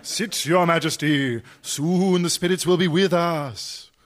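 mock-mystical, rising on the final call; séance medium. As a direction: summon the spirits with eerie, stretched words. Fake medium leads a séance.

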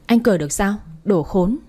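plain reading voice

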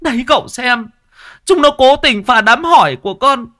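very high, shrill-pitched outraged woman; furious wife. Acted. Bald man's wife rants to Tẩy, very high furious voice. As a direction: shriek your outrage at the wreckers.

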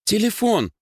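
put-on very high girlish exclamation; sudden cry. A narrator's reading. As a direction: cry out, pitch shooting up. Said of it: "вскрикнула Лёлька". (звонкий вскрик)

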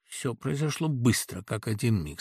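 ordinary delivery

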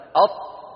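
أط with the tongue held shut on the ط, no release; held stopped taa. Shown as a demonstration. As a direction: press the tongue and trap the air on أط. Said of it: "خليك ماسك لسانك". (أط بحبس الهواء دون إفلات الطاء)

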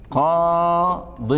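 very slow "qaa-din" for قاض; demonstrated tanween. Shown as a demonstration. Teacher showing the genitive without the article, demonstrated tanween. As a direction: hold the aa of قاض long before the "-din" ending. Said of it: "ستقول".

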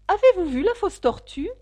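a lightly voiced bossy queen. Queen questioning Alice.

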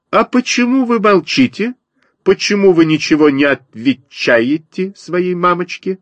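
high, lilting mother sparrow; щебечущая воробьиха. A narrator's reading. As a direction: chirp it out high like a fussing mama bird. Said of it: "продолжала щебетать воробьиха". (высокий щебет воробьихи)

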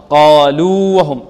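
قالوا with both long vowels held, then وهم; correct separated waws. Shown as a demonstration. Teacher corrects the error, long unmerged waw. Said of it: "خطأ".

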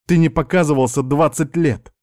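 snappish retort. A narrator's reading. Niece to the long-absent uncle, snapping back. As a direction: snap at him resentfully. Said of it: "огрызнулась женщина".

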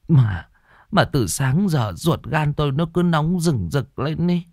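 high, fretful voice; anxious wife. A narrator's reading. Wife tells husband her nightmare about their child, anxious. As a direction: stammer at first, then pour out the worry. Vợ kể ác mộng, bồn chồn.

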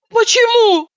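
high, amazed question; genuinely amazed outburst; astonished. A narrator's reading. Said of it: "изумилась я".

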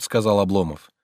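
usual speaking voice

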